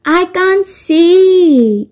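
high, very drawn-out holler; distant shout. A narrator's reading. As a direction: holler it slowly, stretching the last word. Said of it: "shouted Rico".

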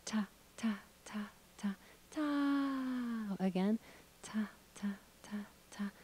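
soothing soft rhythm; soft falling rhythm. Shown as a demonstration. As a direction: whisper the beat like settling a baby. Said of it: "put it together with the rhythm".

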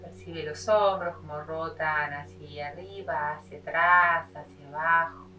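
measured meditation voice with long pauses; unhurried yoga teacher leading a body scan; guided meditation. Acted.

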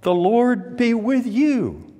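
high, ringing imitation of workers answering; mock group reply, sung out high; called-back response. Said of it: "And they responded".

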